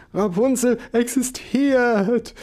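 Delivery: indignant insistence. Acted. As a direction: defend her as if hurt.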